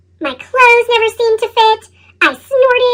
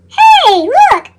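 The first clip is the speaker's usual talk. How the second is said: loud, high call to a friend; 7 semitones higher, 5 dB louder than usual. Acted. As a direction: shout it across the playground. Bystander calls out at seed.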